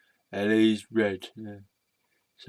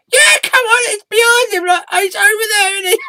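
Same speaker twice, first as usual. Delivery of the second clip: squeaky, high puppet voice shouted; a shrill little puppet yelling to get his turn; high cartoon puppet shout; red sock puppet; 8 dB louder than usual.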